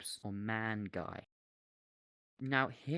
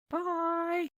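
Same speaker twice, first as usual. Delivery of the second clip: very high, loud, cartoonish goodbye; silly high-pitched farewell; squeaky bye; 7 dB louder than usual. Squeaky sign-off to camera.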